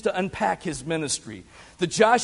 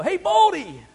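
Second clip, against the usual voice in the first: put-on kids taunting; 12 semitones higher, 5 dB louder than usual. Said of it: "they go".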